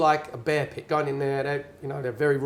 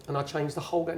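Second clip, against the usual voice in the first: normal talking voice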